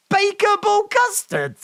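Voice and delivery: very high, loud squeal. Real, not acted. Repeats odd flavor.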